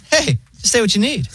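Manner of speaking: high-pitched, breezy AI voice; chill robot operator. Acted. Pharmacy phone AI greets a caller, chill. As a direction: chirp it like a laid-back automated assistant. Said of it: "the robot voice is, like, so chill".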